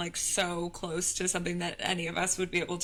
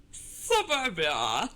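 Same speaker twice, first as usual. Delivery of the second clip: slow, stretched mock Scottish accent; much slower than usual. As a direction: drawl it in a hammed-up Scottish accent. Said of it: "we're doing Australian accents for Scottish".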